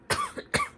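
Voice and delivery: a high put-on cough; mock cough. Sick friends in the story cough, mock-sick.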